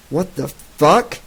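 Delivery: a mild, growing bristle; bristling. Acted. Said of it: "bristling in her grasp".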